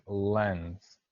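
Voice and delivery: lens drawn out slowly; pronunciation model. Shown as a demonstration. Teacher models lens for the class, slow pronunciation model. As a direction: stretch lens as a single clear word. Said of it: "learn how to pronounce these words".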